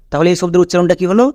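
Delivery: normal talking voice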